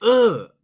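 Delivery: high, loud groan of disgust; disgusted ugh. Retold or imitated. Actor recalls disliking his character, high loud ugh. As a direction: let out a sharp disgusted ugh.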